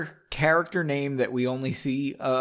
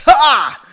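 single loud, high "Ha!"; laugh; 11 semitones higher, 14 dB louder than usual. A narrator's reading. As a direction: bark out one mock laugh. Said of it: "Pulley laughed".

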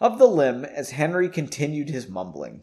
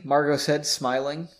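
plain reading voice

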